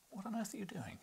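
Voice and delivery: high-pitched real exasperation; exasperated. Real, not acted. Reader to a restless cat in the way, high and exasperated. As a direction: ask it high, baffled by the cat.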